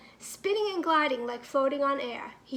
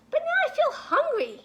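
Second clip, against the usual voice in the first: high little monkey voice; 11 semitones higher, 5 dB louder than usual. Acted. Milo suddenly hungry.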